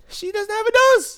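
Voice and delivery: very high, loud outburst; incredulous shriek. Real, not acted. Reacting to Esmeralda's screenshot, incredulous shriek. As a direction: shriek it in disbelief. Shrieking about missing nose.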